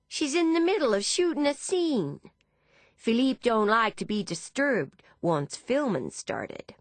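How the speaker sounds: high-pitched Texan woman; Texan accent. A narrator's reading. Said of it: "in an accent similar to Deborah's Texan friend".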